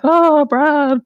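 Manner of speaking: lightly put-on swooning diary voice; mocking weird voice. Brother reading sister's diary aloud, a light mocking swoon. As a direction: tease her by reading her love note in a silly voice. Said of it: "So he's putting on this sort of".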